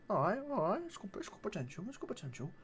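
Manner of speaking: hushed, tentative approval; retold murmur. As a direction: drop right down as if appraising to yourself. Retold first reaction on opening shoes.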